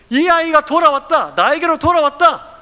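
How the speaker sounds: lightly acted, high-pitched rejoicing; joyful proclamation. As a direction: proclaim it as a father welcoming back a lost child. Preacher voices father welcoming returned son.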